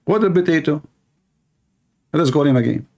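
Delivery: ordinary talk, nothing marked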